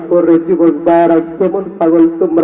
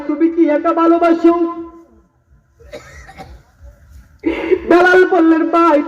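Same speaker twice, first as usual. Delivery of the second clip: high, impassioned sermon voice; fervent waz preacher pleading; preaching at full force; 11 semitones higher than usual. Acted. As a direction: plead as Abu Bakr from the pulpit.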